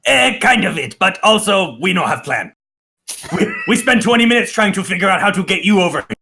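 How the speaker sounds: high-pitched goblin chatter; high goblin voice, broken speech. Acted. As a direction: squeak it out as a goblin. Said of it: "the goblin who hasn't been speaking goes".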